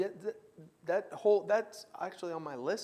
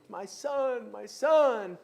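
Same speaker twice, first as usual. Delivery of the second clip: wailing; 4 semitones higher, 4 dB louder, much slower than usual. Retold or imitated. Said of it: "he's crying and wailing".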